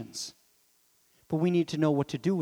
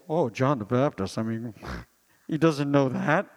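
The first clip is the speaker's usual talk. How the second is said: lower, doubting voice of the crowd; mock skeptic; 4 semitones lower than usual. Preacher voicing doubters scoffing at John, lower and mocking. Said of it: "might start to say".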